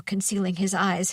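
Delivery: plain reading voice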